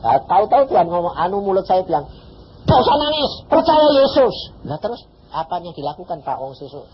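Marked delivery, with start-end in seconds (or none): 2.6-4.5 firm loud command. Retold or imitated. Preacher telling the weeping mother to trust Jesus, loud and firm. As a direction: tell her to stop crying, loud and sure.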